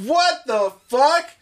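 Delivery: loud, slow disbelief; shocked shout. Retold or imitated. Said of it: "I'm like".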